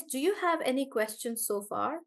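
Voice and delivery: ordinary talk, nothing marked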